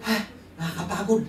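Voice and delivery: high, laughing imitation of a worn-out churchgoer; preacher mocking a member winded from the stairs, laughing whine; mock tired complaint. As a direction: whine it as a put-upon latecomer, laughing through it.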